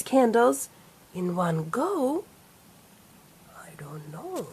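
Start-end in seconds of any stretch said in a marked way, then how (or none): 3.6-4.5 whisper. Acted.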